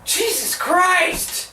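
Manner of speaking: high, drawn-out indignant cry; exasperated outburst. Real, not acted. Reviewer at wit's end with unanswered questions, exasperated cry. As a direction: wail it slowly in disbelief.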